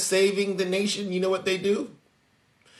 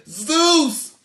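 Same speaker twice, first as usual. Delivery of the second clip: mock follower voice; 7 semitones higher than usual. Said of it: "this is what they say".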